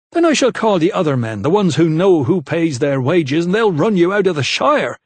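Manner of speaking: furious steward shouting. A narrator's reading. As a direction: yell the threat in his face. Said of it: "he shouted".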